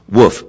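imitated dog bark, short and loud; imitated woof; mock woof. Shown as a demonstration. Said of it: "it then goes like this".

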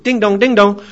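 high, loud sing-song ding-dong; doorbell imitation. Shown as a demonstration. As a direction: chime like a doorbell. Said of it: "The person outside goes".

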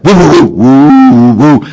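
high, loud barking woofs that rise; imitated dog bark. Dog begging its owner for dinner, loud rising woofs. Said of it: "Please cook me and serve me dinner".

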